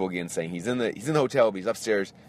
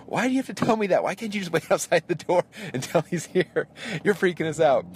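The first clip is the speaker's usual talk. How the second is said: much higher, mock-frustrated pleading; exasperated complaint; 10 semitones higher than usual. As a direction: whine at the guard who keeps teasing you. Exasperated at repeated security guards.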